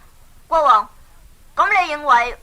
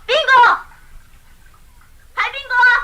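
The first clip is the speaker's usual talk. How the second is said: startled call; 9 semitones higher, much faster than usual. Acted. Startled by unseen voice.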